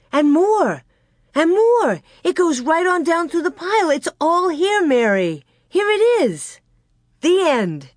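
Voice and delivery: high, thrilled exclamations of discovery; excited cry. A narrator's reading. Girl leafing through the pile for her sister, excited cry. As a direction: keep crying out high, easing at the end. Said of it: "she cried".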